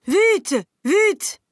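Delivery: frantic high yell; shouting. Acted. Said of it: "criait-il". (cri paniqué, très aigu et fort)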